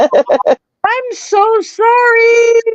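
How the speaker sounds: very high, long-drawn squeal of apology; hammed-up pleading, stretched right out; over-the-top apology. Apologising to someone kept waiting, hammed-up remorse.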